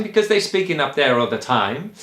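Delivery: lightly put-on Italian accent; terrible Italian accent. Shown as a demonstration. Choir leader showing why Italians are tenors, mock Italian accent. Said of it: "terrible Italian accent".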